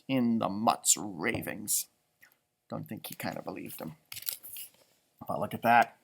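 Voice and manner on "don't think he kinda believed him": ordinary delivery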